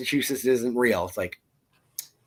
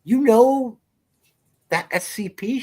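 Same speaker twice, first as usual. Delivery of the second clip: high-pitched incredulous question; retold astonishment; 13 semitones higher, 4 dB louder than usual. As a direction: act out being taken aback by a kid. Retelling own surprised reply.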